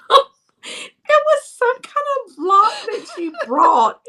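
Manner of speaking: high, slow words squeezed out through laughter; can barely get it out for laughing; laughing hard. Real, not acted. Laughing while retelling.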